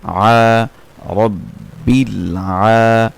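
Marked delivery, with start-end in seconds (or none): 1.1-3.1 রব্বিল আ with the আ held one beat; one alif stretch. Shown as a demonstration. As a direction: draw out আ of রব্বিল আ. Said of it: "এক আলিফ টানতে হয়". (আ এক আলিফ টেনে)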